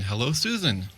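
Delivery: ordinary delivery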